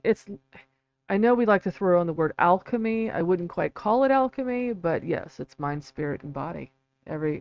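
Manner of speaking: usual speaking voice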